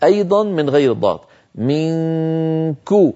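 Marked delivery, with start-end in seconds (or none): 1.5-3.1 منكو drawn out slowly, nasal hum held before a light ku; slow nasalized منكو with a long hum; ikhfa demo. Shown as a demonstration. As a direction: hold the ghunna in منكو long, then press lightly on ku. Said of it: "فلما أصل إلى قولي كو عندئذ أضغط".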